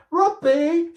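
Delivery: high, loud squeal of his name. A narrator's reading. Girl squealing at her robot during the chase. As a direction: squeal it in delight. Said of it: "she squealed".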